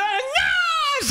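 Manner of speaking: high squeaky newborn wail; imitating a baby's first cry.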